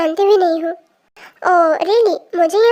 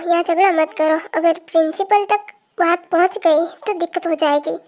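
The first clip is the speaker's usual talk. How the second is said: usual speaking voice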